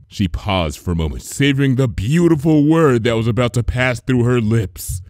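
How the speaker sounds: ordinary delivery, nothing marked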